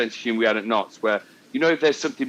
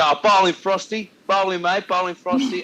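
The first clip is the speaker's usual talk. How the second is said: higher-pitched cricket chirp; imitated fielder's encouragement; 6 semitones higher than usual. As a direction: call it out to the bowler, mimicking a teammate. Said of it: "he goes".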